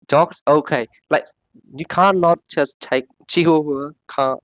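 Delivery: normal talking voice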